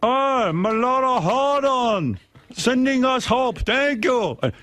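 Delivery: high, slow, badly faked Chilean accent; a comedian hams up a Chilean miner's accent; mock Chilean accent. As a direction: play a trapped Chilean miner in a hammy Chilean accent. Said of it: "I don't know how to do a Chilean accent".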